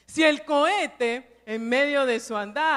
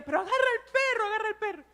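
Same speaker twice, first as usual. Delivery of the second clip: impatient father; 8 semitones higher, much faster than usual. Retold or imitated. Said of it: "mi papá le decía".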